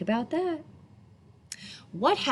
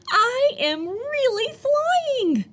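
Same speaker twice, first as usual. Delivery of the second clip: slow, high and thrilled; high drawn-out joyful cry; excited; 5 dB louder, slower than usual. Acted. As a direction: stretch it out in pure delight. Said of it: "said Flip Flop excitedly".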